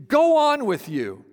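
high, slow, scoffing "go on with you"; dismissive brush-off. Shown as a demonstration. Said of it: "Literally, it would be".

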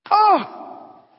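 high, loud startled "Oh!"; shocked gasp. Retold or imitated. Baker reacts to meeting a pastor, loud startled oh. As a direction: jump back in surprise.

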